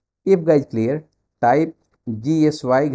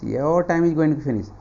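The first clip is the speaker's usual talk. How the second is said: urging reminder; much slower than usual. Real, not acted. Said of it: "urging students on timer".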